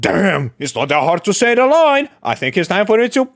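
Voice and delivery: mock the entitled online fan; mock outraged fan. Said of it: "your response is like".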